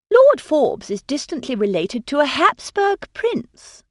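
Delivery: a lightly voiced cheerful society lady; bright. A narrator's reading. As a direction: say it brightly, proud of the connection. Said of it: "Lady Forbes said brightly".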